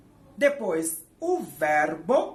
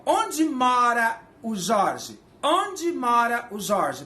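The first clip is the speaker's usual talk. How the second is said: slow, stressed "onde" up front; slow teaching example with "onde" stressed; slow demo question; 3 semitones higher, 4 dB louder, much slower than usual. Shown as a demonstration. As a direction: put "onde" first and say it slowly. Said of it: "Em inglês é assim?".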